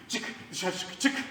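a low hissed get out; hushed furious command to leave; hissed order. Acted. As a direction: push the words out under your breath. Doctor ordering patient out.

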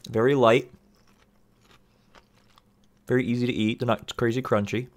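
chewing between words; talking while chewing. Real, not acted. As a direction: mouth partly full. Seen 0: Puts snack in mouth at -4; lips pursed, jaw chewing while talking.

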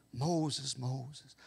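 gentle, hushed call of God; soft divine call. Pretend. God softly calling Moses by name.

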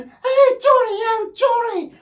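high, loud excited squeals; hammed-up fangirl screaming; mock screaming fans. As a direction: shriek his name like a fan. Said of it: "they're screaming".

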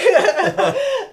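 big high laugh breaking out; sudden loud laugh; loud burst of laughter. Real, not acted. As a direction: crack up loudly mid-sentence. Big laugh mid quote.

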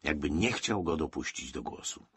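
plain reading voice

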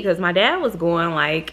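ordinary talk, nothing marked

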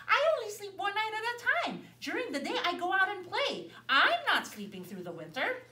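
put-on little boy refusing; boy's voice. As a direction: explain how a boy lives. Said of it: "said Herman".

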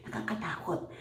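breathy whispered nakakatakot; a scared little whisper; breathy hushed fear. Real, not acted. Scared aside after laugh.

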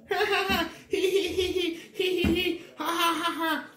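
high taunting hee-hee laugh; high mocking giggle of the audience; mocking viewers' laugh. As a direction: mimic viewers laughing at you. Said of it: "You guys probably over there".

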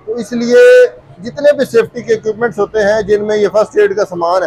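upbeat presenter voice to camera; vlog host intro. Acted. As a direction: welcome the viewers like a YouTube host. Host's video intro to camera.

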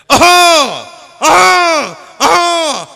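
three high, loud hawks in a row; repeated throat-clearing. Retold or imitated. Uncle in the back seat keeps clearing his throat, repeated hawks. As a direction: keep clearing the throat, over and over.

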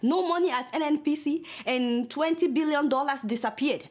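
usual speaking voice

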